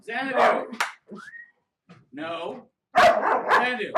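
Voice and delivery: high, loud call to the dog; loud firm dog command. Real, not acted.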